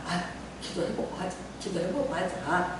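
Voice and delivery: tired pastor quietly tells himself to pray first; quiet self-talk. Retold or imitated. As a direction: murmur it to yourself.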